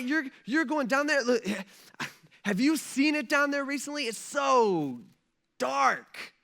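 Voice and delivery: high-pitched, stammering dismay; acted horror with dramatic pauses; appalled disbelief. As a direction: plead with them to reconsider, aghast. Acted angels dismayed at God's descent.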